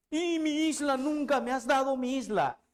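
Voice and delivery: high, complaining sidekick voice; whiny Sancho. Acted. Sancho whining to Quijote about his promised island.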